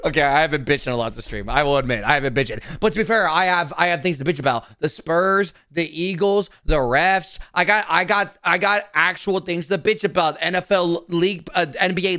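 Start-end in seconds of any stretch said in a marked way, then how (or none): none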